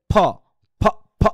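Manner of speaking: short puffed "ফ", repeated; isolated bursts of "ফ", spaced out; aspirated P sound. Shown as a demonstration. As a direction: pop the lips with air on each "ফ". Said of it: "এখানে আবার কি উচ্চারণ করছি".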